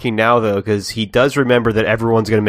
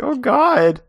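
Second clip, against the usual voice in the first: Jiminy voice; 13 semitones higher, 3 dB louder than usual. Retold or imitated. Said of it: "He's like".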